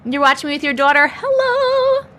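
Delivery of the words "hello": sing-song hello. Real, not acted. Greeting viewer.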